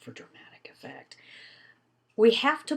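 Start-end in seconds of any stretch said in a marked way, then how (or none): 0.0-1.1 breathy dramatic whisper; stage whisper aside. Shown as a demonstration. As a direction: whisper it for effect. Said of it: "I just paused there".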